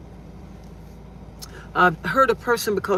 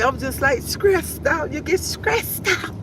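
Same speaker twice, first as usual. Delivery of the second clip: high whiny complaint; 7 semitones higher, slower than usual. Retold or imitated. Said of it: "I said".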